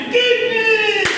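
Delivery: loud, high, drawn-out shout; shouted answer. Real, not acted. Answer shouted after a countdown.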